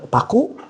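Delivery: "Paku" high and rising; question intonation. Shown as a demonstration. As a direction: ask "Paku" as a one-word question. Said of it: "Tapi ketika intonasinya pertanyaan".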